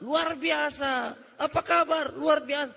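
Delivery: high, drawn-out event chant; imitated crowd cheers. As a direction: mimic the rousing shouts from inside the hall. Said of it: "Di dalam itu terjadi suara-suara".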